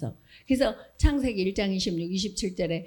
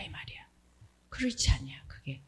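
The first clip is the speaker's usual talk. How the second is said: hushed, unvoiced whisper; 10 semitones lower, 10 dB quieter than usual. Real, not acted. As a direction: lean in and whisper the point. Preacher drops to a whisper.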